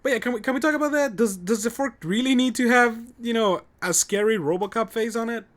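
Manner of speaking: high, loud, fast rant; excited rant. Real, not acted. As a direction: rant about it with a laugh. Ranting about forklift face.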